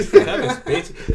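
loud, slow, laughing name. Real, not acted.

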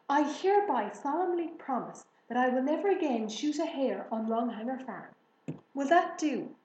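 loud, hammed-up formal vow; solemn promise. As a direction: declare it like an official oath. Father makes a mock-solemn promise.

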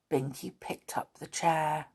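usual speaking voice

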